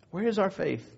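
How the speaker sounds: soft, reflective repeat of the challenge; hushed repeat. Real, not acted. Preacher lets the challenge sink in, hushed. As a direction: drop to a quiet question and let it sink in. Same question repeated softly after pause.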